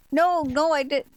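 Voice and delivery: high, loud protest; frantic denial. Acted. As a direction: deny it in panic.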